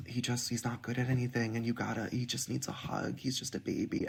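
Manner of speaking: parodying someone who coddles a grown man; mock cooing. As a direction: coo over him like he's a helpless baby. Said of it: "where it's like".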